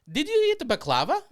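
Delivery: high, loud put-on woman's voice; imitated coworker. Coworker cutting into his story with a question, high mock voice. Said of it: "and she goes".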